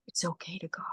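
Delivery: soft voiceless whisper; hushed, breathy reassurance to a dying man. Retold or imitated. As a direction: lean in and whisper it gently. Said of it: "I just whispered".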